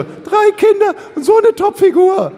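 high, loud mock gushing, drawn out; hammed-up admiring falsetto; gushing mothers. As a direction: squeal the compliment in a slow, exaggerated falsetto. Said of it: "Aber ihr beide so".

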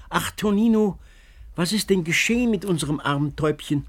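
put-on the old crone wails for her darling; old woman crying out. A narrator's reading. Old woman calls out to Tonino, lamenting. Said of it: "und rief".

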